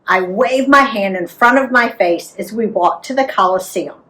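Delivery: ordinary delivery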